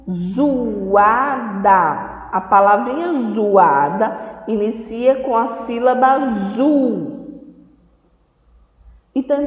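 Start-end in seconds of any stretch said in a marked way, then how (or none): none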